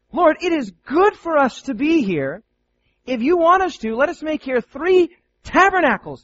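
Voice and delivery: a touch of eager Peter. Acted. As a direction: pitch the idea breathlessly. Peter voiced eager proposal.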